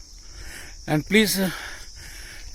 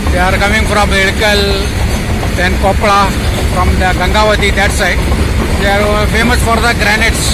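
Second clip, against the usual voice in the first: loud; 8 dB louder than usual. Real, not acted. Talking over passing goods train.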